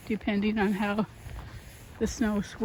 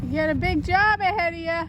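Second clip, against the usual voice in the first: raised, higher voice across the street; calling out; 7 semitones higher, 5 dB louder than usual. Real, not acted. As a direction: call out cheerfully to a neighbour. Calling out to a neighbour shoveling.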